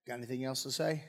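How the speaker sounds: low, hushed deadpan question; wary mock aside. Balaam eyeing his talking donkey afterwards, low wary aside. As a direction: drop pitch and volume for a comic aside.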